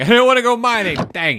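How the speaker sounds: high, loud complaint about a chore; loud protest. Real, not acted. Protests at having to mine.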